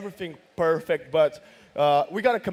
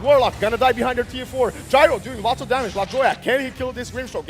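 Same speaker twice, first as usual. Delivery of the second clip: excited esports caster call; play-by-play hype; 8 semitones higher, 8 dB louder than usual. Real, not acted. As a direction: shout the fight call live.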